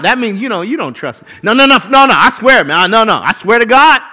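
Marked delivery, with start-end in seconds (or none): 1.4-4.0 loud rapid no-no-no, pleading; mock desperate protest. Preacher acting out a liar begging to be believed, loud. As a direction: protest too much like a caught liar.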